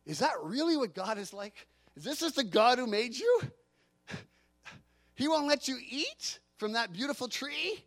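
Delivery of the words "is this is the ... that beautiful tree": usual speaking voice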